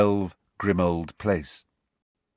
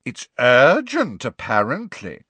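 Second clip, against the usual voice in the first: slow, loud, drawn-out sneer; snide gargoyle; 3 semitones higher, 6 dB louder, slower than usual. A narrator's reading. Second gargoyle to professor about pupil, snidely. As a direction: stretch it out snidely. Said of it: "said the second gargoyle, snidely".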